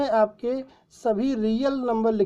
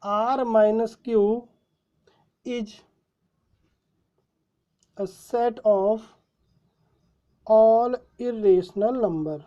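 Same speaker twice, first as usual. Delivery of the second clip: very slow, word by word, as if writing; slow dictation; much slower than usual. Shown as a demonstration. Teacher dictating the answer for students to write, very slow. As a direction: spell it out so they can copy. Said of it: "तो इसको हम क्या लिखेंगे?".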